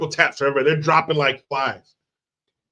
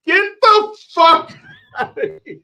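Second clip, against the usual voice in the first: mock yell; 14 semitones higher than usual.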